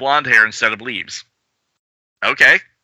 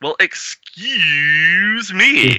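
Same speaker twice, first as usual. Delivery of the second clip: sarcastic retort; 9 semitones higher, much slower than usual. Acted. Sarcastic reply in comic.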